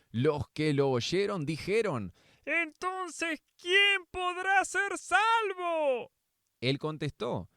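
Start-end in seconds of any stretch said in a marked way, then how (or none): 2.4-6.1 high, slow astonished question; amazed crowd. Acted. Listeners asking Jesus in high, slow disbelief. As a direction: ask it in a high, drawn-out voice of disbelief. Crowd asks who can be saved.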